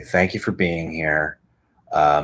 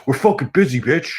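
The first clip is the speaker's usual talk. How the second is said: higher, cocky snarl; mock rude brush-off; 7 semitones higher, 4 dB louder than usual. Amazon voiced as rude, high and cocky. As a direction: toss it off rudely. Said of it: "That's what Amazon did to me".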